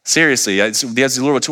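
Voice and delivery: normal talking voice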